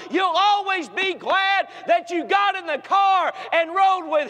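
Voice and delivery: put-on preacher promising the teenagers; preaching climax building. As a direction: build to a final shout.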